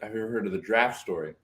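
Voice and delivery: "draft story" said as one-syllable "draft", no middle vowel; draft for giraffe. Real, not acted. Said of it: "It sounds like he's saying draft story".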